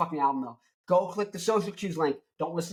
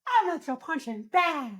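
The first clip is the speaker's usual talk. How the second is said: put-on much higher, slow old lady voice; imitating grandma; 12 semitones higher than usual. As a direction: squeak it out like a scrappy grandma. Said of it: "she goes".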